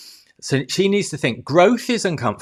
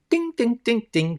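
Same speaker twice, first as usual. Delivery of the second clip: lift bell ding; 10 semitones higher than usual. Pretend.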